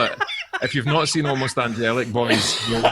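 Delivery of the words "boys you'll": ordinary delivery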